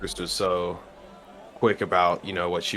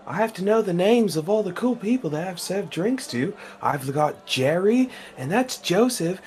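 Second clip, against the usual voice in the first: higher, friendly Aussie-ish barkeep; chummy barkeep voice; 12 semitones higher, 4 dB louder than usual. Acted. Bartender chatting up a stranger at the bar, high and chummy. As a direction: play the chatty bartender calling everyone mate. Player voices character with mate accent.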